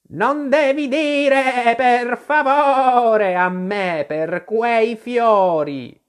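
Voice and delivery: high, slow, bleating-long vowels, loud; an exasperated sheep stretching out every stressed vowel; scolding sheep. Acted. The sheep scolds Alice for spinning round, high drawn-out scolding.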